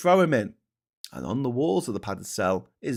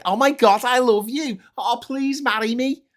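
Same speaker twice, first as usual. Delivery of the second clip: high falsetto swoon, loud at first; mock lovestruck fan; 13 semitones higher, 5 dB louder than usual. Fan proposing to a posh speaker, mock swooning. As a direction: gush it like a hammed-up proposal. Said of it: "Well, it's more like".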